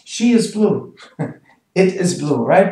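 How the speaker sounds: ordinary delivery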